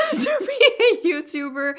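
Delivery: high, loud laugh running through the words. Real, not acted. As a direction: crack up laughing mid-sentence, pitch shooting up. Laughs at own voice complaint.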